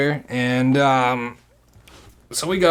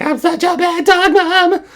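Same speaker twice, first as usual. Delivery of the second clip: much higher, louder whiny wail; mock sobbing; 8 dB louder than usual. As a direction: whine it again drunk. Imitating drunk crying.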